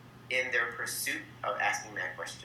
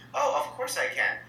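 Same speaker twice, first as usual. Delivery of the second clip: eager upbeat reply; 11 semitones higher, 3 dB louder than usual. Retold or imitated. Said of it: "they're like".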